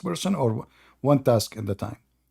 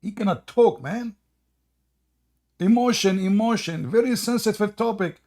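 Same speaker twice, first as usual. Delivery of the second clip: mock emotional; 6 semitones higher than usual. Streamer mocking the video's tearful speaker, hammed up. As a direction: ham up being overcome.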